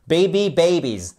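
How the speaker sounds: put-on BABY, BABIES with a full BAY first syllable; model pronunciation. Shown as a demonstration. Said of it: "Et pas baby".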